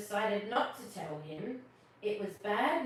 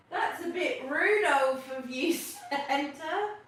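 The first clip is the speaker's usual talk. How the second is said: louder, laughing reindeer voice; Rudolph; 6 semitones higher, 7 dB louder, slower than usual. Acted. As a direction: tease Santa as Rudolph, chuckling. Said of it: "says Rudolph".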